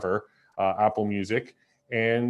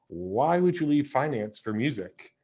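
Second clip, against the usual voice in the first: higher-pitched mock disbelief; sarcastic question, pitch swooping up; 4 semitones higher than usual. Real, not acted. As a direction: ask it with teasing, feigned incredulity. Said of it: "I'm being sarcastic".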